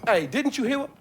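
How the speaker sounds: calling out. Acted. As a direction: shout to stop the stranger. Barman calls out at intruder.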